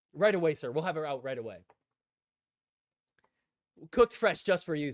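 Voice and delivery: loud, eager shout of right away; a fawning worker racing to serve; eager server. Retold or imitated. Restaurant worker to CJ, loud and fawning.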